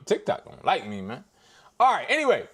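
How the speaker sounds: ordinary talk, nothing marked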